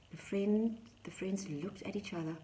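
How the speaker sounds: usual speaking voice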